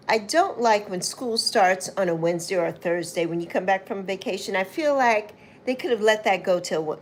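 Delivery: ordinary talk, nothing marked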